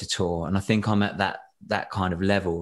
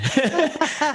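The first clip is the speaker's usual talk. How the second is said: laugh; 4 dB louder than usual. Real, not acted. Laughing after retelling the moment.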